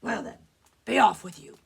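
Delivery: grudging, then loud troll dismissal. Acted. Troll lets the little goat go, gruff and loud. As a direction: build to a booming send-off. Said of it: "said the troll".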